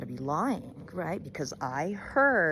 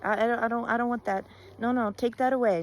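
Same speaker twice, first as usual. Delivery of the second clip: fast, raised protest; refusing animal; 4 semitones higher, 4 dB louder, much faster than usual. Acted. Animal refusing Rabbit's gossip, fast and raised. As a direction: wave the gossip away. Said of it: "would tell Rabbit".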